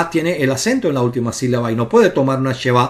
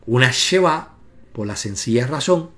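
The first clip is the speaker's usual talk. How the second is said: usual speaking voice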